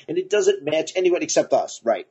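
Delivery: ordinary talk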